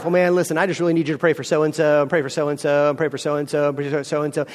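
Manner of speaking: low, monotone run of requests; deflecting. Acted. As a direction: brush the question off with a droning list. Deflecting prayer request, acted.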